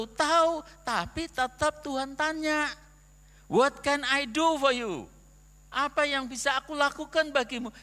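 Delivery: loud, high, driving sermon delivery; the pastor presses his point about asking God, preaching loudly; full-force preaching. Acted. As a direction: preach it out with conviction.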